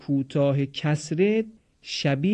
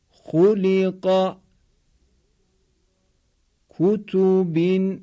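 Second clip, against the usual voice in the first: very slow, higher, level chant-like syllables; repeat-after-me model; 4 semitones higher, 4 dB louder, much slower than usual. Shown as a demonstration. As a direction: hold every syllable evenly on one raised note. (شمرده و کشیده با آهنگ یکنواخت، برای تکرار)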